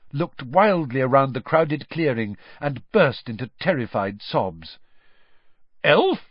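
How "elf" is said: sharp, high and loud summons; stern. A narrator's reading. Official confronting the sobbing elf, stern. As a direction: address an underling sternly. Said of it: "said Mister Diggory sternly".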